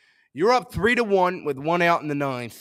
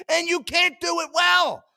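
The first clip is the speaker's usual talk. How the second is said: frustrated shout; 12 semitones higher than usual. Real, not acted. Fan raging at pitcher's pay.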